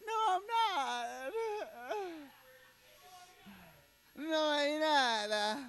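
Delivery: high, stretched denial in a put-on voice; George Lopez impression. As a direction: deny it as George Lopez would. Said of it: "George Lopez impression".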